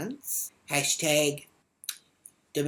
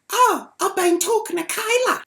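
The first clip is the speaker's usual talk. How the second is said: high, loud mock whine; mocking impersonation, loud and high; mimicked angry daughter; 10 dB louder than usual. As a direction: imitate her, voice pitched way up. Retold doorstep row, mimicking the daughter.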